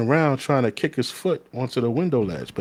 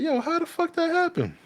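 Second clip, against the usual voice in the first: much higher, disbelieving mock squeal; shocked homeboy. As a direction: play the friend who can't believe it.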